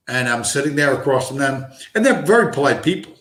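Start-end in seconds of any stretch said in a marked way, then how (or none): none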